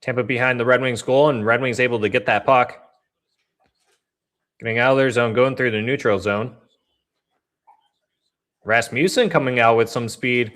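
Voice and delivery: live game call, even and steady; casual hockey commentary; play-by-play. Acted. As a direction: call the puck as it moves.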